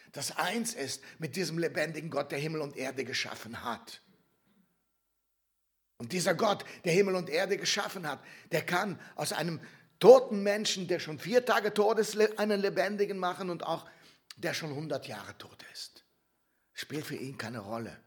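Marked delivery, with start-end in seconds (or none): 6.0-16.0 loud, high, insistent proclamation; preaching at full force. Real, not acted. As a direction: drive it home loudly from the pulpit. Predigt mit voller Kraft. (kraftvoll und laut predigend)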